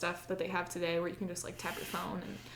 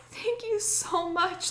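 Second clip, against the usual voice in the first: overjoyed thanks; 11 semitones higher, much slower than usual. Retold or imitated. Hugging the woman who helped.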